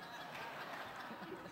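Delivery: a quiet, voiceless little laugh; soft laugh. Real, not acted. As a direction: laugh softly at her own joke. Joking about getting dressed up.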